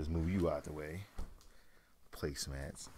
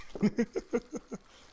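breathless laugh; 6 dB louder than usual. Real, not acted. Laughs after the box comes out.

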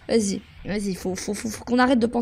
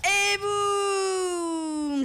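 higher, drawn-out victory cry; triumphant call; 5 semitones higher, 4 dB quieter than usual. Real, not acted. Streamer celebrating another kill, drawn-out triumphant call. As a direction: stretch out the celebration.

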